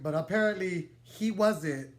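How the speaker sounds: ordinary delivery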